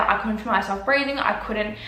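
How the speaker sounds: usual speaking voice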